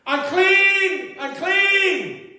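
loud high cry of warning; a leper crying out unclean on seeing Jesus; desperate yell. Acted. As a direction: shout it out as he rounds the corner.

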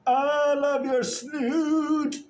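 squealing dog-owner coo; doting coo. Real, not acted. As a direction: stretch each word out lovingly to the dog. Cooing at her dog.

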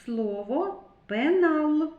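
teacher sounding out a word; slow teaching demo. Shown as a demonstration. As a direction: spell out пенал slowly for small children. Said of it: "Звук Л стоїть у середині слова".

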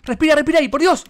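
high, loud cry for air; desperate shout. Real, not acted. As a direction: yell it out, gasping for breath. Surfacing after nearly drowning.

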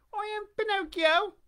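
put-on high, squeaky wooden-boy voice; high puppet voice. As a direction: pipe it up like a puppet.

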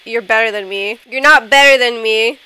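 ordinary delivery

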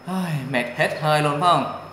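usual speaking voice

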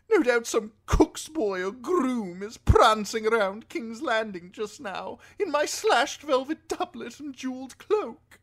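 high-pitched, indignant highborn lord; Lord Alistair. A narrator's reading. Lord laments his lost fine clothes, fussy and indignant. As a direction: complain in a high aristocratic voice, starting loud.

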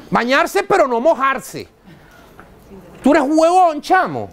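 ordinary delivery

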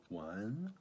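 normal talking voice

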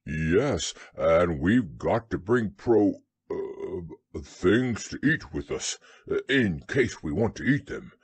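low bear voice; deep bear fumbling for his words; muddled Pooh. A narrator's reading. Bear explains provisions to Rabbit, low and halting.

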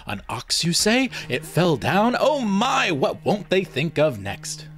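sarcastic pretend awe; feigned astonishment. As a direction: overplay the wonder to mock him. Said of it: "I gasped in feigned astonishment".

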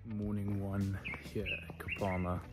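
hushed early-morning whisper. Real, not acted. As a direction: whisper so as not to wake anyone.

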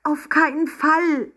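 high, slow, distressed protest; unhappy cry. A narrator's reading. Emma denies wanting to spoil things, unhappy cry. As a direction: cry it out miserably. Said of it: "rief Emma unglücklich".

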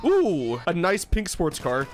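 ordinary delivery

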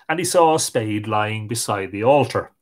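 usual speaking voice

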